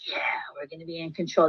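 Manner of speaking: low, hushed mock takeover; hushed voice playing the English; acted quiet assertion. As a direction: say it softly, nearly whispering, as the new boss.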